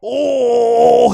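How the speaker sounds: loud, high "Oh" at a big hit; sudden loud excited cry; excited shout. Real, not acted. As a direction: burst out at the body shot. Reacting to body shot.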